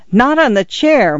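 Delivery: a mild alarmed cry; cried out. A narrator's reading. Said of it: "Missus Stoner cried".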